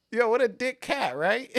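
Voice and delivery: high, amused indignation at the cat; mock outrage. Real, not acted. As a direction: laugh it out, mock offended.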